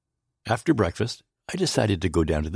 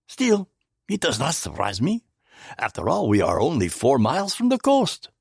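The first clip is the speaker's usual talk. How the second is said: Poirot voice; 6 semitones higher than usual. A narrator's reading.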